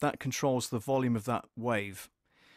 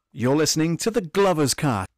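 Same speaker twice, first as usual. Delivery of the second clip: distortion; 4 semitones higher, 10 dB louder than usual. Acted. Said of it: "we peaked there".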